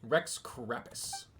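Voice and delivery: low, slow, soft parody of a storyteller; mocking echo. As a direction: drag the silly name out quietly.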